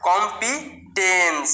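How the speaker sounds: drawn-out teacher model of কম্পিটেন্স; slow demo pronunciation. Shown as a demonstration. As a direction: model কম্পিটেন্স slowly for students. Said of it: "কিরকম হবে?".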